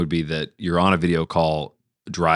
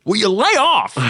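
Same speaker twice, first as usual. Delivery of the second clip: high, loud polite protest; flustered Jimmy Stewart; 13 semitones higher, 4 dB louder than usual. Pretend. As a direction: beg them to stop, still polite. Jimmy Stewart being scalped.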